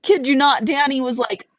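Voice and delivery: ordinary talk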